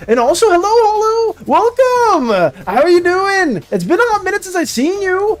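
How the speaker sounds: bright, high, eager welcome; excited greeting. Real, not acted. Greeting a returning viewer on stream.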